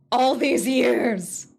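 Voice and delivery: slow loud anguished cry; anguished outburst. Acted. Louder slow repeat.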